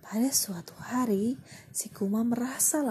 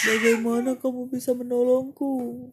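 loud lion; 3 semitones higher, 8 dB louder than usual. Acted. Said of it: "tanya singa".

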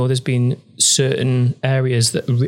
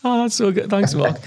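high, laughing thanks; tickled, higher-pitched reply; 8 semitones higher than usual. Real, not acted. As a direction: say it through real laughter. Laughs at the compliment.

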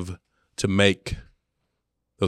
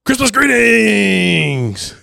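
slow, much higher shout of Christmas greetings; dad's over-eager camera shout; stiff shouted greeting; 13 semitones higher, 10 dB louder, much slower than usual. Retold or imitated. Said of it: "And he goes".

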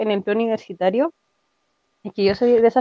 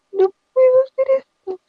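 much higher, slow overwrought cry; melodramatic wail. Retold or imitated. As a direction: ham up the teenage panic. Dramatic confession on the phone. (lamento melodramático, lento y mucho más agudo)

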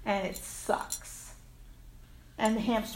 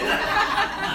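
a burst of loud high laughter; 9 semitones higher, 8 dB louder than usual. Real, not acted. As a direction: let a real laugh burst out. Laughs at her own aside.

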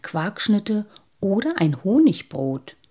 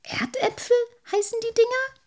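very high, wondering sprite voice; amazed little sprite. A narrator's reading. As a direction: marvel at the strange word.

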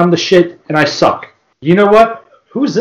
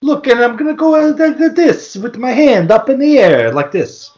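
mock meathead; 13 semitones higher than usual. Mocking wrestler Vito demanding his Italian-flag gear, high and hammed-up. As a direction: ham up a proud dim wrestler.

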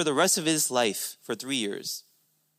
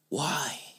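whispered question; 4 semitones lower, 8 dB quieter than usual. Acted. Preacher poses question in whisper.

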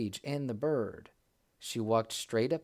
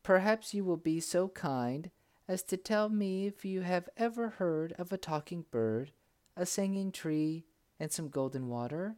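polite; 7 semitones higher than usual. A narrator's reading. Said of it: "she said politely".

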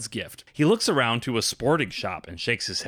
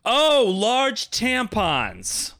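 loud slow repeat; 10 semitones higher, 4 dB louder, much slower than usual. Acted. Clerk repeats it loudly.

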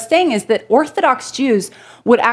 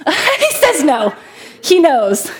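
laughing; 5 semitones higher, slower than usual. Real, not acted. Laughing at own joke flop.

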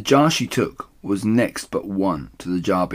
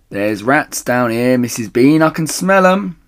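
calling up; 6 semitones higher, 8 dB louder than usual. Acted. Mabel calls up about the rats, loud and high. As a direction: warn her up the stairs.

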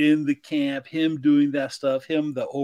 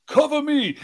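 high-pitched call for cover; mock battle call; 13 semitones higher than usual. Character about to run out, high mock battle call. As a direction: call out high, imitating a soldier.

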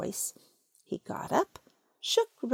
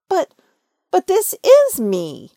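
higher, louder startled protest; very surprised; 9 semitones higher, 12 dB louder than usual. Acted. Pooh, surprised, insists to Rabbit it's really him. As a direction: stumble in amazement. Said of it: "very much surprised".

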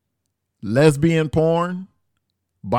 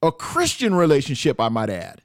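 usual speaking voice

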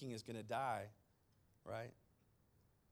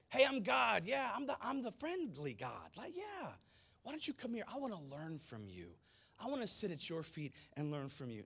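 high, chummy mock-casual God voice; mock friendly God; 5 semitones higher than usual. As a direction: play a needy, too-friendly God, higher pitched. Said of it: "notice that God doesn't say".

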